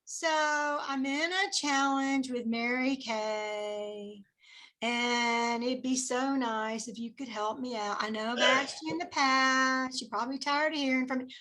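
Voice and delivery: slow, soft, apologetic; flat, timid ask. Shown as a demonstration. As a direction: mumble it like you expect a no. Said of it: "Okay, you gotta get excited".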